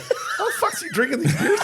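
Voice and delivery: high disbelieving laughing squeal; incredulous. Retold or imitated. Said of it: "And I'm like".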